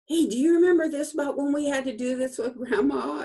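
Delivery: high, loud, rapid-fire question; eager recollection. Retold or imitated. She calls her cousin about Grandma, eager recollection. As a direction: rush it out excitedly, as if phoning family.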